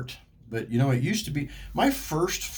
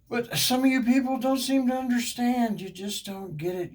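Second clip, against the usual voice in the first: ranting; 14 semitones higher than usual. Real, not acted. Griping at drivers on their phones, high and exasperated. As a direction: let the frustration burst out.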